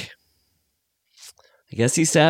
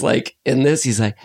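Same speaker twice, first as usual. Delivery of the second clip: ordinary talk, nothing marked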